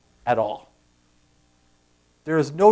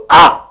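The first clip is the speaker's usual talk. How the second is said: short, sharp, high cry; collective gasp; 11 semitones higher, 11 dB louder than usual. Retold or imitated. Preacher imitating everyone in the silent gym, loud sudden gasp. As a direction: mimic everyone gasping at once. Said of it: "It's just everyone goes".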